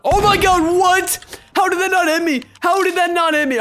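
high, loud amazed outcry; shocked disbelief. Real, not acted. Gamer dodging flying knives, high disbelieving cries. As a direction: cry out in disbelief.

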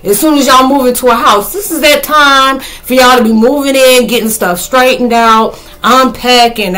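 worked-up, emphatic grumbling; exasperated reaction. Retold or imitated. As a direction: protest in mild disbelief. Exasperated reaction to mother leaving.

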